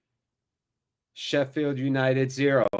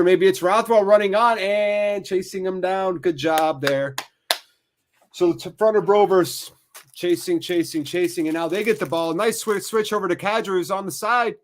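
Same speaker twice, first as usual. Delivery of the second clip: animated, higher-pitched soccer commentary; live play-by-play; 5 semitones higher, 3 dB louder than usual. Real, not acted. Commentator calling a chase for the ball, animated play-by-play. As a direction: follow the chase with rising urgency, then settle.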